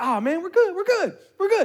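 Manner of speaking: high, easygoing mock reassurance; carefree friend reassuring; breezy reassurance. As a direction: brush off the worry lightly. Friend calmly reassuring, repeated.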